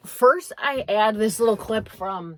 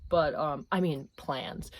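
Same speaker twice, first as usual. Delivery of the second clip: usual speaking voice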